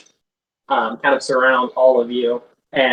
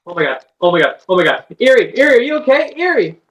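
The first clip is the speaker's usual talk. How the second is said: repeated pleas climbing much higher; alarmed concern; 8 semitones higher, 3 dB louder, faster than usual. Acted. Nozel checking on knocked-out Eri, alarm rising. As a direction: build from worry to a high-pitched call. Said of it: "looking really concerned".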